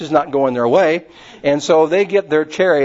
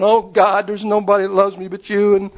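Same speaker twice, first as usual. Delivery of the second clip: Elijah's lament; 5 semitones higher than usual. Retold or imitated. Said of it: "And he's going".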